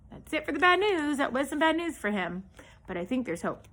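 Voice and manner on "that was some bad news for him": usual speaking voice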